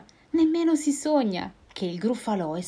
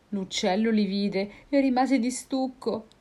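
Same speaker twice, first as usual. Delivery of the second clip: plain reading voice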